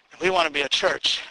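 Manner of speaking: usual speaking voice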